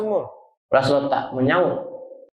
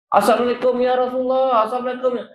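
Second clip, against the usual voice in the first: higher, pleading call; calling at the door; 6 semitones higher than usual. Acted. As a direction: call again, a little more anxious. Abu Bakar calling again. (Abu Bakar memanggil Rasulullah dengan suara tinggi)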